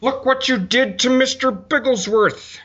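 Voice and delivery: slow, high Doctor Evil outrage. Shown as a demonstration. To his cohost, attempting a rusty Doctor Evil. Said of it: "since I did Doctor Evil".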